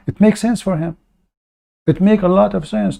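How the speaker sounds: high, mocking fake agreement; sarcastic. Real, not acted. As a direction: pretend to agree, dripping sarcasm.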